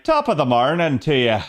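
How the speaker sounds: hammed-up fake Irish lilt; bad Irish accent. Shown as a demonstration. Showing chat what bad Irish sounds like, fake Irish accent. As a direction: do a deliberately bad Irish greeting. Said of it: "a bad Irish accent would be like".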